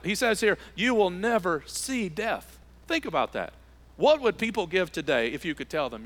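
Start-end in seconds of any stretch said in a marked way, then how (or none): 0.7-2.6 slow emphatic quote. Acted. Preacher quotes Jesus slowly.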